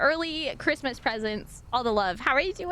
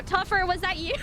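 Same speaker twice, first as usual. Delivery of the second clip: ordinary talk, nothing marked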